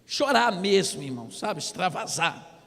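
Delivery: ordinary talk, nothing marked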